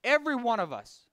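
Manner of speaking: ordinary talk, nothing marked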